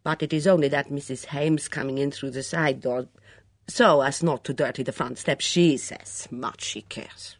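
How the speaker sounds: put-on Mitzi, nervous then bitter, in a foreign accent. Mitzi voiced by narrator.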